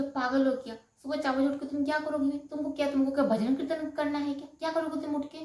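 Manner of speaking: mock him ridiculing the idea; husband scoffing at his bride's dawn routine, mocking. Husband scolds her for waking.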